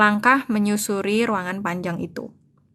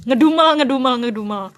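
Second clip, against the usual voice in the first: mock grumble, higher and louder at first; grumbling; 4 semitones higher, 6 dB louder, faster than usual. Shown as a demonstration. Teacher showing what grumbling sounds like, mock grumble. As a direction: act out a grumble for the class. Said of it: "Ya rada ngedumel gitu lah".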